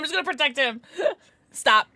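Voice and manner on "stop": ramp each stop up to a yell; building to a shout. Real, not acted. Begging the game to stop, louder each time.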